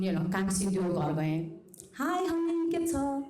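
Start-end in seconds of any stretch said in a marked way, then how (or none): 2.0-3.2 high, chirpy sing-song hello; sweet greeting. Retold or imitated. To her husband on arriving home, high sweet hello. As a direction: greet him brightly, all sweetness.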